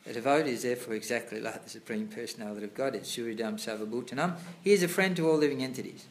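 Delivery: loud, formal reading of scripture; reading aloud. Acted. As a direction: read the passage out like a sermon. Reads purport aloud louder.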